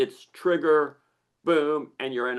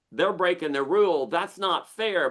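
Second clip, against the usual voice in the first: indignant complaint; 6 semitones higher than usual. Acted. Acting out complaint of unfairness.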